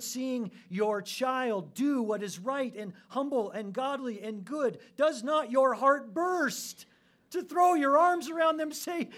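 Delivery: loud, high, building to a cry; impassioned preaching. Real, not acted. Preacher urging listeners to imagine a parent's pride, loud and fervent. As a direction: preach at full force, swelling toward the climax.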